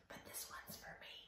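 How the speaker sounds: unvoiced, fast secret whisper; playful conspiratorial whisper; sneaky whisper. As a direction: whisper it to yourself like stealing a treat. Sneaky aside taking last cone.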